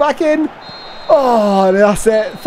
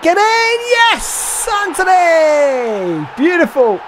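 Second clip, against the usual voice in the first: goal celebration yell; 7 semitones higher, much slower than usual. Real, not acted. Gamer cheering Antony's goal, ecstatic drawn-out shout. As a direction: roar the scorer's name. Goal scored in his game.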